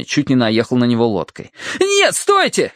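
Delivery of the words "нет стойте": very high cry of warning; alarmed shout. A narrator's reading. Terence in the water stops the oncoming boat, alarmed shout. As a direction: yell to halt them. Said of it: "крикнул Терренс".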